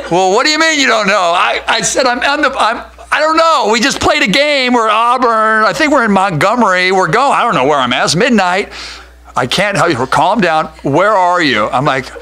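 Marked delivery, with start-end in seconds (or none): 0.1-1.3 exasperated dispatcher. Retold or imitated. 911 operator exasperated. 1.3-8.7 high, loud, frantic rambling; a lost caller scrambling for answers; panicked caller. Retold or imitated. Retold panicked 911 call. 9.4-11.7 stern dispatcher. Retold or imitated. Operator scolding.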